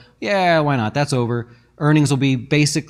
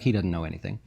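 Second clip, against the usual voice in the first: under the breath, unimpressed; muttered judgment; 6 semitones lower, 6 dB quieter, faster than usual. Retold or imitated. Neighbor privately judging the guest, low and soft. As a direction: lower and softer, dismissive.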